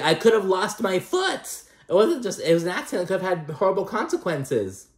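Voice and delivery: high-pitched imitation of an aggrieved complaint; mock melodrama. Imitating Adriana's dramatic complaint.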